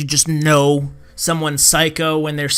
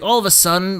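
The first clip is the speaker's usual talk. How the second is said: ordinary talk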